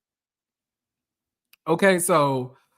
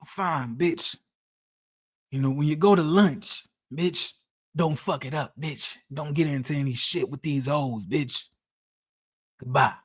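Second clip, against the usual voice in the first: Charles voice; 5 semitones lower, 4 dB quieter than usual. Retold or imitated. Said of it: "Y'all want me to do the Charles voice".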